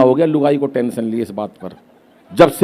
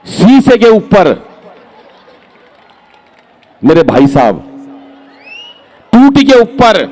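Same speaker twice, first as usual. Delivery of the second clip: drawn-out reveal; 2 semitones higher, slower than usual. Acted. Punchline reveal of photos, drawn out.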